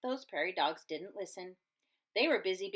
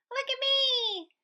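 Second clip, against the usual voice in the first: high, slow, louder squeal; showing off; 5 dB louder than usual. Acted. Pip preening to the crowd, high and delighted. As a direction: show off in a tiny high voice. Pip shows off fuzz hat.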